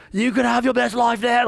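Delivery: high-pitched fake Australian preacher; mock Australian accent. Pastor mocking prosperity-gospel preachers, in a put-on Australian accent. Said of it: "like, Australian accents or something".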